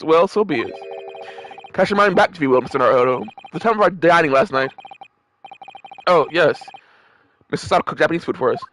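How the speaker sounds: normal talking voice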